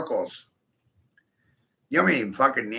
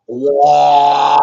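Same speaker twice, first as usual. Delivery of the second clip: excited whoa; 12 dB louder than usual. Pretend. Shark blood rush again.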